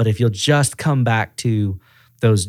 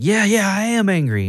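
imitating a worked-up customer, pitched up; higher-voiced, mock-irritated admission; retold anger; 9 semitones higher than usual. Upset customer answering the manager, mildly angry. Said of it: "you're seeming a little angry".

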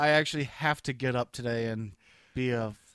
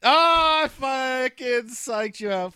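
much higher, louder triumphant crow; gloating shout; 11 semitones higher, 8 dB louder, slower than usual. Real, not acted. Gloating to his cohost, loud and high. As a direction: crow that you fooled him.

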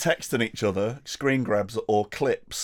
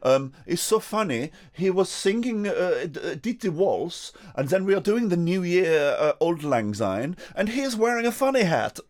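gushing fan; 6 semitones higher than usual. Retold or imitated. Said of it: "In their, in their world".

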